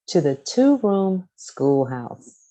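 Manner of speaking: plain reading voice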